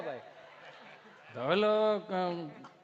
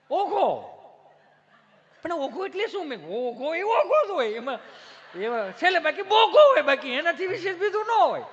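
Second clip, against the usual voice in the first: higher, louder excited back-and-forth; excited banter; 8 semitones higher, 7 dB louder than usual. Retold or imitated. Comedian bantering with city tourists about the bush: high and loud. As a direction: bounce the lines up with glee.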